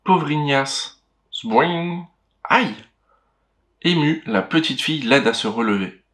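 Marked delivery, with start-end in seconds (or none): 1.3-2.1 high springy boing; boing sound effect. Acted. 2.4-2.8 high little yelp of pain; ouch. Acted. Ignace falls.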